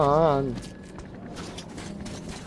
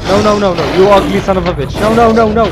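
high loud frantic no's; panicked shouting; 6 semitones higher, 11 dB louder than usual. Real, not acted. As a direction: scream at the monster in panic. Fights ugly monster in panic.

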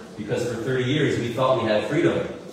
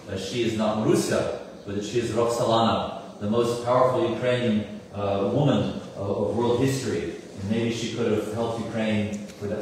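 ordinary delivery

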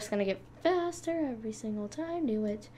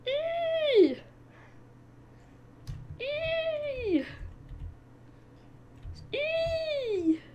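high squeal; much slower than usual. Real, not acted. Repeated squeals while dying.

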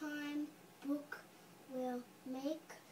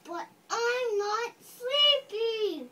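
louder, higher insistent refusal; kid's loud protest; 7 semitones higher, 11 dB louder than usual. Acted. Child voicing the book's kid at the angry part, loud. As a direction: demand it like a kid fighting bedtime. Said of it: "This is the angry part".

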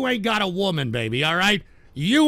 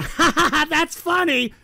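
higher, sarcastic fake laugh; a hammed-up laugh of a girlfriend who isn't there; mock laughter; 8 semitones higher, 3 dB louder than usual. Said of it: "in the background going".

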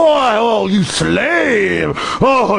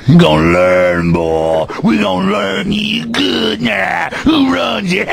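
threatening cop drawl; 2 semitones lower than usual. Retold or imitated. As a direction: promise him a lesson. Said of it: "that's an imitation of 'em".

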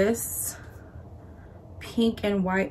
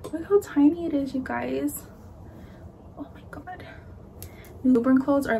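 excited gushing; 5 semitones higher, faster than usual. Real, not acted. Gushing over tiny newborn clothes.

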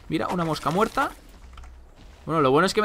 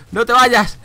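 loud plea; 9 semitones higher, 9 dB louder, slower than usual. Real, not acted. Begging the pig not to leave.

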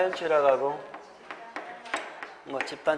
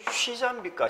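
쉬자 with Gyeongsang accent; Gyeongsang dialect sounds; 8 semitones higher than usual. Shown as a demonstration. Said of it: "우리 경상도 말로는". (경상도 사투리로 쉬자)

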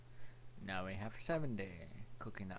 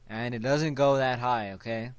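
loud; 2 semitones higher, 12 dB louder than usual. Real, not acted. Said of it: "my voice is kinda deep".

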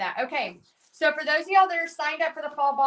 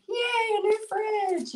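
high-pitched mock-gleeful squeal; drawn-out, sing-out little celebration; excited cheer; 10 semitones higher, slower than usual. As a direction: cheer it high, hamming up childlike glee. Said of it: "I'm excited about a fridge".

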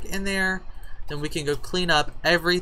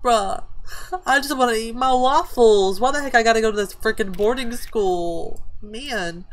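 voicing a sulky teenager, higher and louder; whiny teen voice; 6 semitones higher, 4 dB louder than usual. Player voicing her sim daughter as a grumpy teen. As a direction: grumble like a kid who wants her waffles. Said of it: "She's like".

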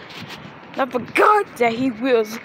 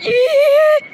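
high piercing shriek at the train; imitating a train whistle screech; mock shriek; 13 semitones higher, 7 dB louder than usual. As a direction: squeal it out high and sharp. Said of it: "you're like".

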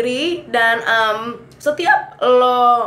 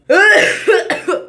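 loud laugh; 10 semitones higher, 5 dB louder than usual. Real, not acted. Laughs after demo.

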